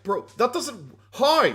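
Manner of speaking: bro, HOW, in disbelief; rising to a baffled shout; incredulous. Retold or imitated. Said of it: "I was like".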